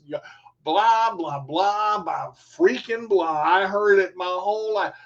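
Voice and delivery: ordinary delivery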